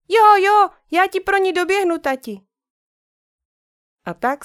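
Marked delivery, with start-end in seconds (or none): 0.1-2.4 high, loud delighted squeal; excited girl. Acted. Sister answers dad, bouncing with delight. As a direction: jump for joy in the voice. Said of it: "poskočila a zatleskala".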